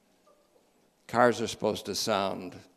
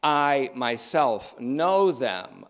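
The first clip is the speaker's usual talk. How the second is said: slow, weighty stress on "I" and "know"; emphatic I; 4 dB louder, much slower than usual. Shown as a demonstration. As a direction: draw out "I", set each word apart. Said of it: "It's emphatic".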